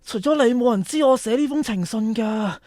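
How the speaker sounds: ordinary talk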